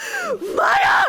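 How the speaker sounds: high, loud shriek of the name; laughing shout. Real, not acted. Streamer calling out her friend Maya, laughing shout.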